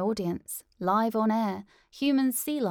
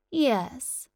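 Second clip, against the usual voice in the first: ordinary delivery, nothing marked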